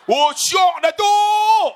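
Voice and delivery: loud, high whoop of delight; thrilled exclamation. Retold or imitated. Re-enacts thrill of motorbike braking.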